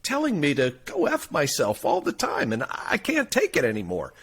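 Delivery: exasperated customer. Acted. Guy complaining to the store owner, exasperated. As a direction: plead your grievance as a fed-up customer. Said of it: "he says".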